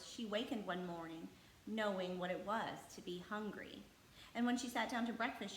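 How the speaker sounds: usual speaking voice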